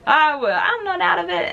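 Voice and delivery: high, loud mock drunk insistence; tipsy denial. Imitating a drunk friend denying it, high and loud. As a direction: play a tipsy friend swearing they're fine. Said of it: "drinking with your friends".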